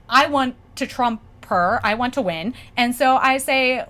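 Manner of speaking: ordinary talk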